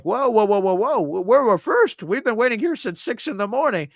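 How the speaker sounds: acted-out "wait, hold on" indignation; indignant protest. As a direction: cut in with alarmed disbelief. Acted objection to being moved.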